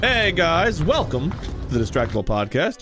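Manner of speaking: normal talking voice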